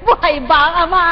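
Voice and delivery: preacher shouting at the congregation. Real, not acted. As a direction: shout the question out. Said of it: "napapasigaw ako eh".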